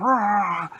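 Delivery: loud high grabbing roar; monster roar. Acted. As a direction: roar as you snatch him up. Monster grabs a kid.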